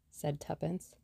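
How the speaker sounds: usual speaking voice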